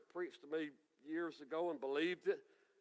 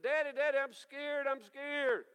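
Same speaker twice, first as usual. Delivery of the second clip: put-on high, pleading child's cry; a small boy begging his daddy; scared little boy; 9 semitones higher, 4 dB louder than usual. Little boy runs to daddy in the storm, high and pleading.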